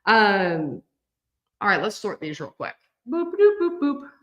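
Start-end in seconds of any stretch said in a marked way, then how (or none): 3.1-4.1 high, bouncy little boop noises while sorting; playful boops. Real, not acted. Sorting fabric into piles, playful high boops. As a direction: chirp boop boop as each pile lands.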